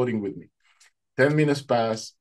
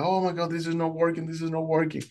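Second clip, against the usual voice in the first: higher-pitched dismayed cry; retold panic; 6 semitones higher, faster than usual. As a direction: voice the swimmer's mounting alarm. Swimmer panics in retold rescue.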